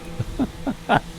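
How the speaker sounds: breathy laugh. Real, not acted. Laughs at own joke.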